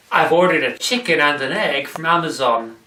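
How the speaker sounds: normal talking voice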